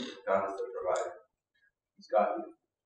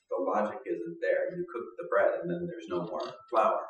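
ordinary delivery

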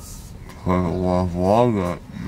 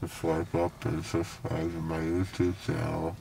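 slurred numb mouth; talking with a numb mouth; numb from the dentist; 9 dB quieter than usual. Real, not acted. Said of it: "everything in my mouth feels numb".

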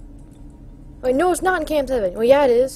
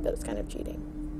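whisper; 15 dB quieter than usual. Real, not acted. Hiding in dark from animatronics.

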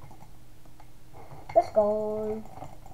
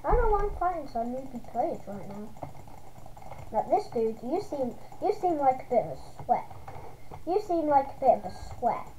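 normal talking voice

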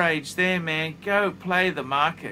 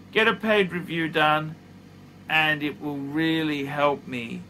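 ordinary talk

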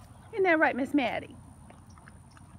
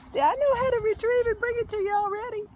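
high, fast, louder puppy voice; voicing the dog; 9 semitones higher, 4 dB louder, faster than usual. Owner voices the puppy's boast, high and quick. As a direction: chatter as the proud puppy.

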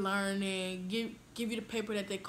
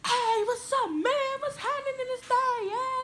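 hammed-up mock boy greeting, much higher and louder; 5 dB louder than usual. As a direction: overdo a bro's swaggering hello. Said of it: "how y'all boys be like".

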